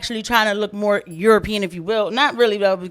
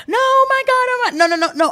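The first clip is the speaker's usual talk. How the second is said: much higher, squealing mock panic; mock flustered denial. Host imitating the guest caught out, high squealed denial. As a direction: play her caught out and backpedalling.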